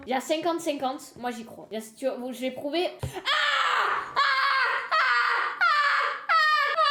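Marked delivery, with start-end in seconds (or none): 3.0-6.8 sharp startled cries at the worm; short scared yelps. Real, not acted. Streamer spots a worm and yelps in fright. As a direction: yelp in fear each time it moves.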